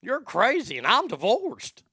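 quoting [name]. Retold or imitated. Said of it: "[name] knows how to give a line".

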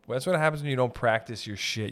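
normal talking voice

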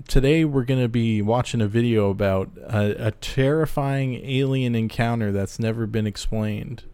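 ordinary talk, nothing marked

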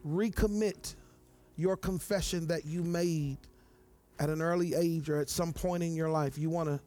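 soft, low prayer voice. Acted. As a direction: pray gently and invite them forward. Soft prayer and altar call.

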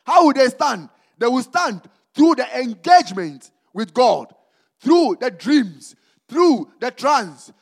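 loud, high preaching with long drawn-out words; slow emphatic proclamation, punching the key words. Real, not acted. Preacher proclaiming scripture to the church, forceful preaching.

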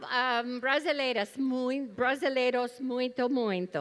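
halting Portuguese with English accent; stumbling learner Portuguese, English accent. Real, not acted. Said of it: "attempt to speak Portuguese".

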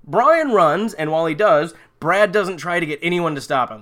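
usual speaking voice

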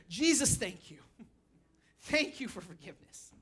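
quiet thanks spoken to Jesus; sincere prayer. Shown as a demonstration. Models a prayer after forceful preaching.

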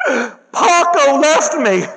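high, slow mock whimper of a girl; heartbroken teen girl. Preacher voicing the dumped student, high and slow. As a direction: voice a moping teenage girl.

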